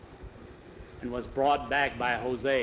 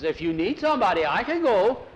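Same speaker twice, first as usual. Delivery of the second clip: louder and higher, pushing in; eager offer; 6 semitones higher, 5 dB louder than usual. A narrator's reading. Pedro eagerly offering himself to Jose, loud and high. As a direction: jump at the chance to go.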